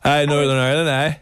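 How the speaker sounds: put-on old Northern Irish man; old man's Northern accent. Host showing off for his co-host, mock Northern Irish old man. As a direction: ham up a Northern Ireland accent. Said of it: "put on an old man's voice when you're doing it".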